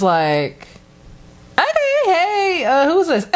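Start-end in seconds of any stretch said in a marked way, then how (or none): 1.6-3.3 high-pitched, tentative hello, acted out; puzzled phone greeting. Answering an unknown caller, puzzled and high-pitched. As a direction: retell it way up high, breaking into a laugh.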